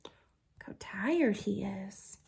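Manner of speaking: slow hushed whisper. Real, not acted. Reader showing children the sleeping dragon, slow whisper. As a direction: whisper so the dragon stays asleep.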